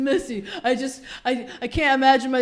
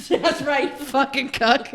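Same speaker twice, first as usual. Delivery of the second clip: higher jeer through laughter; laughing taunt; 4 semitones higher, 4 dB louder, slower than usual. Acted. Woman replaying the taunt at husband, laughing mock scorn. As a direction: crack up while playing the bully.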